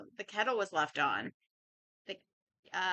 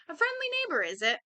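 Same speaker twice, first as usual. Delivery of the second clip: suspicious scoff; 8 semitones higher, faster than usual. Acted. Gruff Flint doubting the caller.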